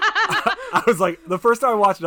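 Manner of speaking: high, loud laughing through words. Real, not acted. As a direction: laugh as you say it. Laughing while recalling the scene.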